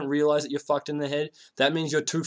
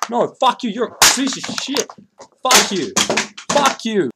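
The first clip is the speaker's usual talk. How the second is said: much higher, much louder bratty yelling; hammed-up shouting fit, louder and higher; mock screaming tantrum; 8 semitones higher, 8 dB louder than usual. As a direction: play a furious kid screaming at everyone. Voicing shooter lashing out.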